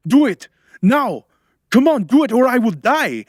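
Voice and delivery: high, loud, frantic command; desperate shouting. A narrator's reading. Man urging helper to save his life, shouting. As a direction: yell it as if life depends on it.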